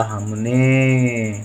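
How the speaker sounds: very slow, stretched out; drawn out syllables. Shown as a demonstration. Teacher dictating a Hindi word to pupils, drawn out slowly. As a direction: sound it out slowly for the class. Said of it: "teacher sounds out word".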